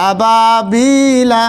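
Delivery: slow, long-drawn madd; stretched vowel. Shown as a demonstration. Teacher showing students the long pull. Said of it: "তিন আলিফ পরিমাণ টান হবে".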